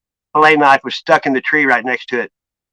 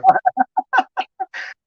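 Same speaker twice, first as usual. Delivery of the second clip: high squealing laugh. Real, not acted. Laughing at lap steel joke.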